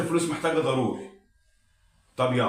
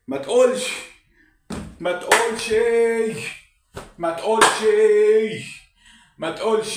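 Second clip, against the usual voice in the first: raised, rising pleas to the falling market; desperate pleading; 5 semitones higher, 4 dB louder than usual. Acted. Gambler pleading at the trading screen, raised and desperate. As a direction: beg the screen not to crash. Gambler begging the stock not to drop.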